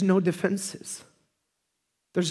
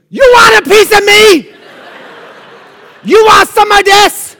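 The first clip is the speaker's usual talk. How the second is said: much higher, loud mock shouting kid; tough toddler challenge; 19 dB louder than usual. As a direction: shout the tough-guy challenge like a fired-up little boy. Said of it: "and he goes".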